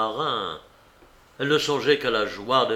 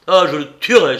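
loud, higher murderous threat; through clenched teeth; 5 semitones higher, 12 dB louder, faster than usual. A narrator's reading. Marin vows revenge on the master, teeth clenched. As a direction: vow to kill him. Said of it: "en serrant les dents".

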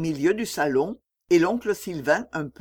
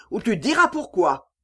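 angry threat; 4 semitones higher, 3 dB louder than usual. A narrator's reading. Oncle menace l'enfant.

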